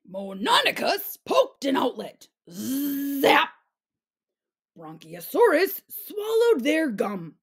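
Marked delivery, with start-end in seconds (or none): none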